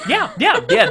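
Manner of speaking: sudden high, loud agreement; a burst of excited agreement; excited yes. Real, not acted. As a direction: yes yes, you got it, eagerly. Confirming she was right.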